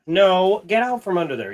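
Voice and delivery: sharp, high "no" easing into a firm order; scolding the dog. Real, not acted. Scolding dog under the desk.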